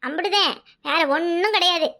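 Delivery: ordinary delivery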